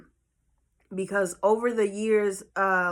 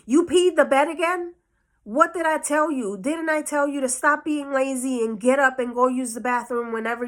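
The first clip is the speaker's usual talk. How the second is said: higher, indignant mock telling-off; angry scolding mother; 3 semitones higher, 3 dB louder than usual. Mother finds the bed wet and scolds her child, angry.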